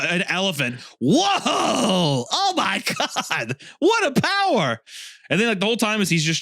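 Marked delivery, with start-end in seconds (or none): 1.0-4.8 high, hammy wonder; mock amazement. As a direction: gasp at a lame superpower. Mocking Beast Boy's elephant power.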